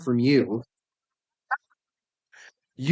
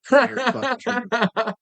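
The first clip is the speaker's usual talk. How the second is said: drawn-out mock agreement; 8 semitones higher, much slower than usual. Real, not acted. Mock-agreeing politician joke.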